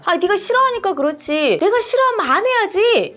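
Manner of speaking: annoyed husband's retort, rising high; hammed-up irritated husband; mock quarrel. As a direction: snap back defensively, voice climbing. Husband and wife quarrel acted.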